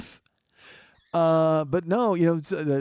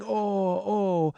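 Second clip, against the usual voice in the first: drawn-out, higher mock moans; imitated moaning; 6 semitones higher than usual. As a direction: moan in mock pain like the injured man. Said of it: "he's laying there moaning".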